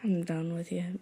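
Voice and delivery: whispered, playful giving up; mock-exasperated whisper. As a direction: whisper it as if fed up. Mock exasperation at a teasing co-host.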